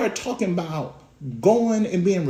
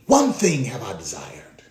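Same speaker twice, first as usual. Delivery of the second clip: whisper; 8 semitones lower than usual. Acted. Whispered scripture quote.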